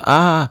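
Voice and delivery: short, high pretend scream; acted yelp; mock cry of pain. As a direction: cry out as if bitten. Tony being bitten.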